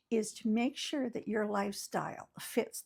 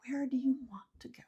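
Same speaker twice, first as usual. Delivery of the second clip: quick breathy whispered question; a quick secretive whisper to the viewer; 3 semitones higher, faster than usual. Real, not acted. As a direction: lean in and whisper it fast.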